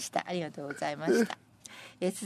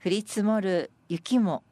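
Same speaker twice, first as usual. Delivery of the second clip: raised, projected verse reading with a punchy last phrase; senryu recitation; 5 semitones higher, 4 dB louder, slower than usual. Acted. Presenter reading senryu about unwanted snow, emphatic recitation. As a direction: declaim the verse, landing hard on the ending.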